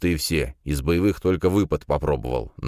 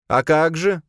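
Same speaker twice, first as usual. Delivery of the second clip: high, bright-eyed student voice pleading with the rector; a hopeful young man asking a favour; eager young student; 13 semitones higher, 5 dB louder, slower than usual. A narrator's reading. Student hopefully asks the rector about a club, in a high young voice.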